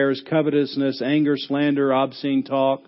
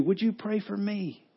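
pleading request; 4 semitones higher, 10 dB quieter than usual. Retold or imitated. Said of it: "Jesus has asked you".

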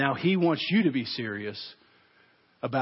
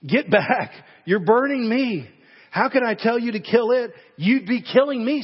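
higher and louder, pleading in pain; panicked cry; 8 semitones higher, 6 dB louder than usual. Acted. Man begging the burning angel to stop, panicked and loud. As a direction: recoil from the burning angel, crying out. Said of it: "said the man".

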